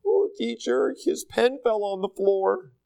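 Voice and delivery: high, whiny schoolkid tattling; tattletale. Retold or imitated. Preacher mimics a school tattletale, whiny and high. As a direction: tattle to the teacher in a little kid voice. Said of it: "the tattletale".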